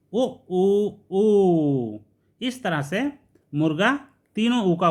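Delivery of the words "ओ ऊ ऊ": drawn-out, louder crowing ओ ऊ ऊ; rooster crow. Retold or imitated. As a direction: crow like a rooster, stretching each ऊ. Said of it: "ए ऊ का उच्चारण करता है". (मुर्गे की बाँग की नकल, खिंचा हुआ ऊ)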